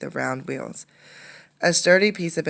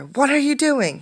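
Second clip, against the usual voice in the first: high, loud stern challenge; stern demand; 11 semitones higher, 6 dB louder than usual. Acted. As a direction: confront the boy sharply. Policeman grabs Condi.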